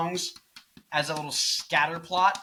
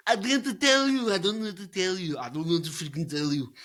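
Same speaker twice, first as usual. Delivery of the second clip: Arnold voice; 2 semitones higher than usual. Pretend. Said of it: "Why are you doing the Arnold voice?".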